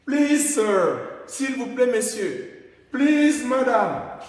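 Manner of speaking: high and loud please; polite attention call. Shown as a demonstration. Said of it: "vous ne connaissez pas la personne".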